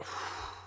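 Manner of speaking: unvoiced sigh as the chest drops; slumping sigh. Shown as a demonstration. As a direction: sigh out and let the body slump. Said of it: "a feeling of, oh, slumping".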